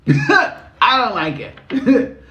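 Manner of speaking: high, drawn-out words through laughter; laughing. Real, not acted. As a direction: laugh as you object. Laughing over her own joke.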